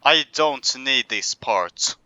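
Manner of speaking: ordinary talk, nothing marked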